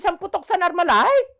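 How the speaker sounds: loud neighbor telling of shots; loud retold report. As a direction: shout the news of gunfire. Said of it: "Ang sabi".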